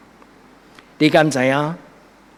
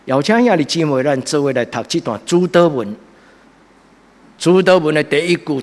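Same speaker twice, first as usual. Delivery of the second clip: normal talking voice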